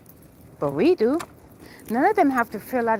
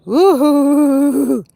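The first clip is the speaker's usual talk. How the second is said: loud whooping cheer; joyful loud whoop; 3 semitones higher, 9 dB louder than usual. Real, not acted. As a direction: let out a big woohoo. Cheering on stream.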